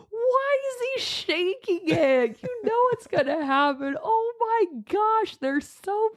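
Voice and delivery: very high, cracked-up squeal; hammed-up giggly wail, words stretched; imitating hard laughter. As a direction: imitate someone helpless with amusement. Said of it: "He's laughing so hard".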